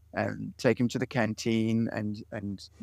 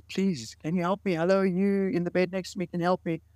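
pleading call; 9 semitones higher than usual. Retold or imitated. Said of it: "And the guy got loud".

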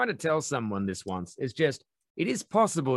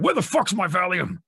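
high, louder hammed-up demand for pills; desperate searching; 8 semitones higher, 5 dB louder than usual. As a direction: stomp round the house demanding the Valium. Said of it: "walked around the house going".

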